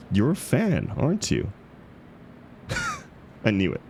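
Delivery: high, giggling gotcha tease; playful teasing. Acted. Teasing the book club partner about being a fan, giggly. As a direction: catch them out with a laugh.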